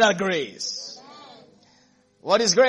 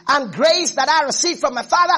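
higher, louder building sermon; fervent preaching; 5 semitones higher, 3 dB louder than usual. Acted. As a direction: rise up and proclaim it. Preaching at full force.

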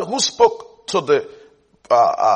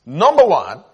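ordinary talk

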